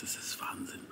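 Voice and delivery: breathy hushed whisper. Real, not acted. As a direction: whisper it in awe. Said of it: "ich kann nicht so laut sprechen".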